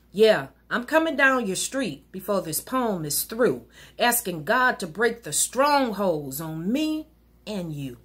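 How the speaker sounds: normal talking voice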